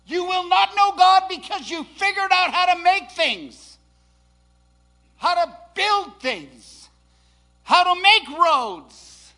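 loud, slow, hammering preacher cadence; rising to a shouted, slow climax; full-force preaching. Real, not acted. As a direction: preach it hard, each phrase driven home.

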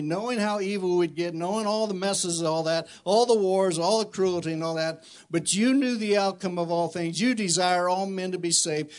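fervent spoken prayer over music; earnest pastoral prayer, swelling. Acted. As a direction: pray aloud with rising fervor.

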